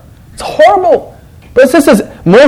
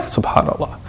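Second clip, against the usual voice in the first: whispered sigh; 12 dB quieter than usual. Real, not acted. Sad sigh after reactions.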